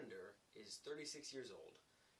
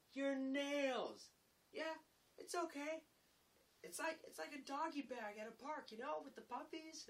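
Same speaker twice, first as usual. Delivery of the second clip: high, loud teasing; mocking taunt; 6 dB louder than usual. Laborer cleaning up carpenters' nails, mockingly reassuring. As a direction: rub it in with mock reassurance.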